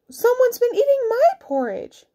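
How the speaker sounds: very high mother bear voice. A narrator's reading. Mother bear finds porridge eaten, high indignant voice. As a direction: complain in a high falsetto.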